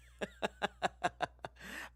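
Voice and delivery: high, wheezing laugh at own mistake; long wheezy laugh. Real, not acted. Streamer realizing the country mix-up, wheezing laugh. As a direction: crack up helplessly.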